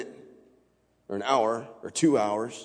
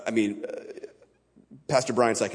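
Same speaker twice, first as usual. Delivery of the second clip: ordinary delivery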